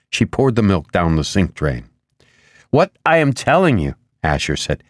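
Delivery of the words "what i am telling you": higher, emphatic insistence; insistent. A narrator's reading. Asher telling Ribas something important, insistent. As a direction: press the point home.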